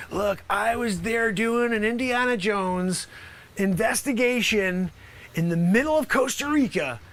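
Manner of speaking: ordinary talk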